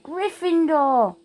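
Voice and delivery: high, drawn-out triumphant call; hat shouting. Acted. The Sorting Hat announcing Ron's house, triumphant shout. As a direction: announce it to the whole hall. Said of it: "the hat shouted".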